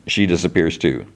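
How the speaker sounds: ordinary delivery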